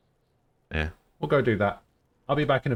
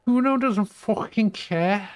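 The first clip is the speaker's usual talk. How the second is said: high, slow, hammed-up Scouse accent; a bad but earnest try at a Liverpool accent; attempted Scouse accent; 13 semitones higher, 4 dB louder, slower than usual. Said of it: "I can't do a Scouse accent".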